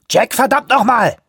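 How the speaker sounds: loud, high angry call across the water; angry shout. Acted. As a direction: yell his name in frustration. Anawak calls out across water. (wütender Ruf)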